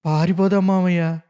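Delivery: a touch of crying, pleading; understated weeping plea; tearful plea. A narrator's reading. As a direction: beg to run away through tears. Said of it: "అంతకంటే ఏడుస్తూ అన్నాను నేను".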